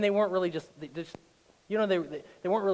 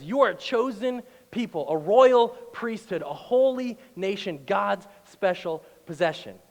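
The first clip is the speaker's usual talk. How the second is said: slow, swelling read, louder and higher; scripture reading; 5 semitones higher, 8 dB louder, slower than usual. Acted. Pastor reading scripture to the church, slow and rising. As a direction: lift the verse slowly to the room. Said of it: "chapter two, verse nine says".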